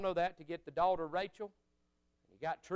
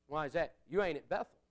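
ordinary talk

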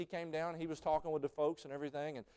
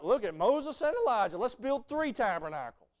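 eager disciple; 9 semitones higher, 10 dB louder than usual. Retold or imitated. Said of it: "And he's like".

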